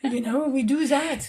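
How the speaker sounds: playful French-accented English; English spoken like a French person. Shown as a demonstration. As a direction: toss it off in a put-on French accent. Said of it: "it's funny that when you try".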